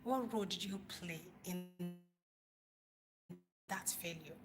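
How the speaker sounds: ordinary delivery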